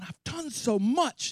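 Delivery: high, slow, aggrieved cry; exasperated God. Acted. God lamenting all he did for Israel, high and slow. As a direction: let the hurt stretch out slowly. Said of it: "he's going".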